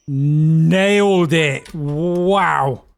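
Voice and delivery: loud, stretched-out cheer of triumph; triumphant. Real, not acted. Driver cheering a perfect stop at the platform, triumphant and loud. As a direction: crow it like you just nailed the landing. Stopping the train just right.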